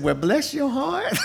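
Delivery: high, slow drawn-out church lady voice with a laugh; older church lady. Retold or imitated. Greeting a former student she taught, high and slow with a laugh. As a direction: coo it like a sweet old churchgoer. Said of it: "She said".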